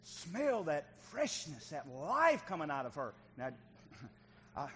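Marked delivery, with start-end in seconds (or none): 0.0-3.1 slow, drawn-out relish with loud swells; rapturous savouring. Retold or imitated. New father savouring the baby's smell, slow rapture. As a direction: breathe it in, awed and thrilled.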